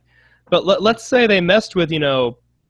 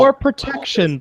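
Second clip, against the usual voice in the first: sarcastic; 9 semitones higher than usual. Pretend. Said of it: "ironic repeat of excuse".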